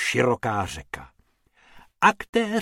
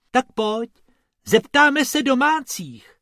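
high-pitched eager suggestion; keen, raised-pitch invitation; urging along; 12 semitones higher, 4 dB louder than usual. A narrator's reading. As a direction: chivvy a friend on. Rabbit urges his friend along.

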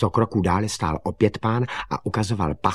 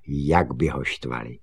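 plain reading voice

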